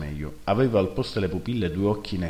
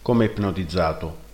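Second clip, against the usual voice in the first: ordinary delivery, nothing marked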